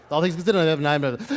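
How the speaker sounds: a second fast take of the one-breath station intro; news director impression. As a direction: fire it off fast like the boss on air. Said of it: "That's his breath".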